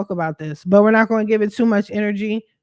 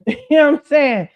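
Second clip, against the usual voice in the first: laughs, then asks it louder and higher; laughing; 6 semitones higher, 7 dB louder than usual. Real, not acted. Brushing off critics in her live chat, laughing. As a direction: laugh it off at the haters.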